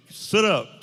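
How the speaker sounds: high, loud command imitating the boss; retold sharp order. Walmart boss to a sleepy man on the phone, loud commanding. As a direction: reenact a boss rousing someone.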